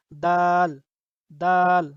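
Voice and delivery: দাল said loud and clear as a model; loud demo of দাল; clear letter demo. Shown as a demonstration. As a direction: announce দাল to the class. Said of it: "Bangla তার উচ্চারণ দ আকার দায়ের মতো".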